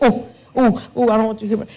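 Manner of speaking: high, fretful imitation of a timid driver; lightly mocking worried driver; mock careful driver. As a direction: play up a nervous old-school driver.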